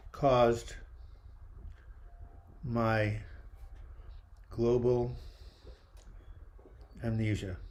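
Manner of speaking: slow solemn release, long pauses between words; ritual declaration. Acted. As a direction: speak it like a ceremonial letting go. Burning bowl ritual, long pauses.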